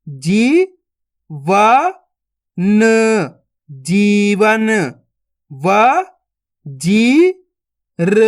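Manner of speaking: each syllable spaced out, then the word blended slowly; syllable-by-syllable reading. Shown as a demonstration. Teacher to young students: slow sounding-out of each syllable.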